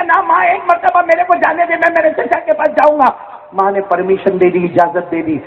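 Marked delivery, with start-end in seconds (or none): 0.0-3.1 put-on insistent child.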